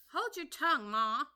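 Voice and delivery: high, loud snap at mother; snappish daughter. Acted. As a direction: snap back at Ma. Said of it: "said the young crab a little snappishly".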